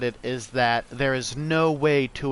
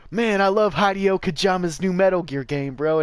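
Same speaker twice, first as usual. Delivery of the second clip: younger self; 6 semitones higher, 5 dB louder than usual. Retold or imitated. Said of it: "I'd be like".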